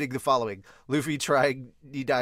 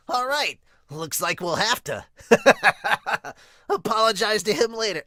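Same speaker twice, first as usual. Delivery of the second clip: higher, louder, laughing through it; a cheerful captain chuckling; laughing Luffy voice; 7 semitones higher, 7 dB louder than usual. Acted.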